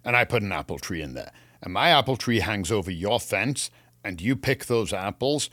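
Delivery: ordinary talk, nothing marked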